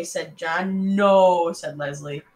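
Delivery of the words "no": loud flat refusal; one more kid shouting no. Acted. Kids refusing loudly.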